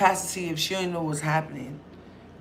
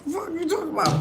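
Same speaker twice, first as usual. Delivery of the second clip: high incredulous; 11 semitones higher than usual. Retold or imitated. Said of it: "My cousin said".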